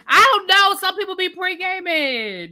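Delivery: high, laughing reply to a viewer; amused aside. Real, not acted. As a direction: tease the chat back. Answering chat, amused.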